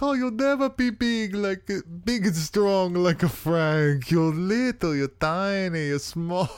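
Italian mom. Retold or imitated. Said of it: "and the mom's like".

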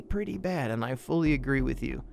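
ordinary talk, nothing marked

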